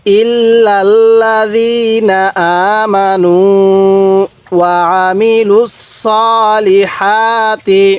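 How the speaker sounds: slow, drawn-out chanted tilawat; Quran recitation. Acted. Teacher recites the surah for revision, slow chant. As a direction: recite it with long measured vowels.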